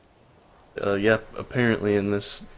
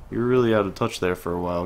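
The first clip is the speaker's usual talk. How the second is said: ordinary talk, nothing marked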